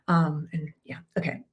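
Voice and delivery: whispered trailing aside. Real, not acted. Coach whispering as she leaves the anecdote. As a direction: let it fall into a whisper.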